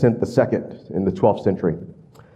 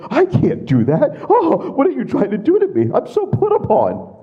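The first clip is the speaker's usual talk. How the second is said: high, laughing, whiny complaint; mocking the king's self-pity, high and laughing; whiny put-upon king. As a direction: laugh through a mock self-pitying king. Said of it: "He's like".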